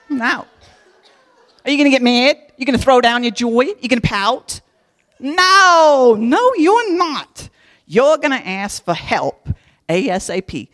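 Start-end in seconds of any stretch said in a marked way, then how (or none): 5.2-7.5 higher, louder, slow insistent no; emphatic no. Real, not acted. To listeners tempted to pout, emphatic slow denial. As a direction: shut the idea down firmly. Emphatic answer to own question.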